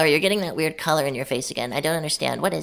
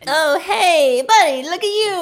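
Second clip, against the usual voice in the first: very high, loud cooing; cooing baby talk; 13 semitones higher, 8 dB louder, slower than usual. Pretend. Player cooing over the guard's smashed face, very high. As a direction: coo at him like a hurt puppy.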